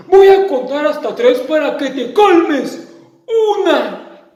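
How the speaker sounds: cuenta aguda y fuerte; monstruo amenazante. Acted. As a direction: advierte contando. (monstruo cuenta amenazando)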